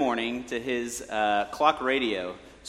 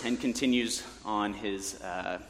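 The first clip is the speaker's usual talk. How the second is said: usual speaking voice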